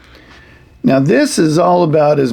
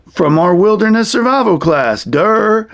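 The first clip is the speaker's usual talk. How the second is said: higher-pitched, duh-style comeback; mocking puppet hero; 7 semitones higher than usual. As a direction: puppet stating the obvious, goofy high "durr". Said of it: "Mister D says".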